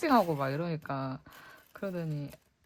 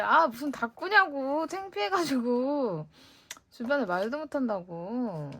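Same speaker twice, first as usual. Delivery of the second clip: mock complaint; 5 semitones higher, 5 dB louder than usual. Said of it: "막 그랬었다?".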